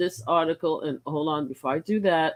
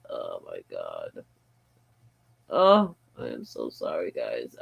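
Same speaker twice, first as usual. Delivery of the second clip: usual speaking voice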